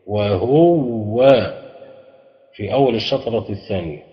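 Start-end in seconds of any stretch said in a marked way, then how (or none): 0.1-1.3 وهو very slow, "wa-huww-wa" with a long held w; doubled waw in وهو. Shown as a demonstration. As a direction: lean on the waw of وهو and hold it. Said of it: "في تشديد".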